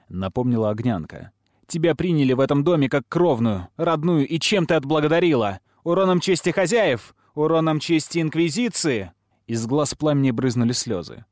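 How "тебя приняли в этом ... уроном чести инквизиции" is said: higher-pitched, pressing reproach; heated sisterly rebuke; indignant scolding. A narrator's reading. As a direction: scold her with rising indignation.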